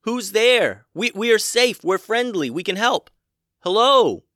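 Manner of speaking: loud high reassuring shout; yelling. A narrator's reading. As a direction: call out so the hidden one hears. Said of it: "Max yelled out again".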